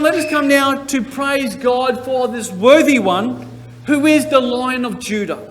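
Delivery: usual speaking voice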